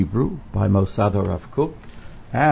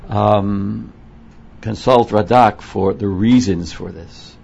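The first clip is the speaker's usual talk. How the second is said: ordinary talk, nothing marked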